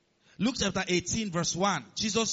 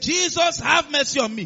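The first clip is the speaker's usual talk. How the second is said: loud, high-pitched plea; crying out for mercy; 9 semitones higher, 6 dB louder than usual. Acted. The sick calling to Jesus for healing, crying out. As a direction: call out loudly as if begging from a crowd. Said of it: "Yet when they said".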